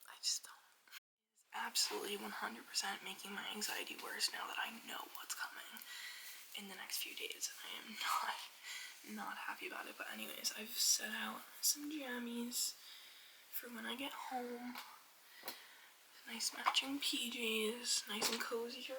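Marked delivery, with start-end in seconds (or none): 0.1-0.7 short quiet whisper. Real, not acted. 1.6-10.0 soft unvoiced whisper. Real, not acted. 10.6-18.0 soft unvoiced whisper. Real, not acted.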